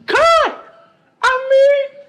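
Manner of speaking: loud, high outburst; exclamation. Real, not acted. Preacher erupting at his congregation in a loud cry. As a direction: burst out suddenly from the pulpit.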